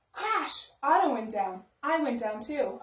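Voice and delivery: plain reading voice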